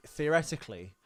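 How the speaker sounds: usual speaking voice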